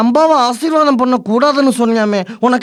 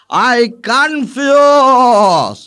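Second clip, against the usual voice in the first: long drawn-out bewildered yell; confused shout; 4 dB louder, much slower than usual. Acted. Cook shouting his confusion at everyone, loud and drawn out. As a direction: stretch it out, baffled.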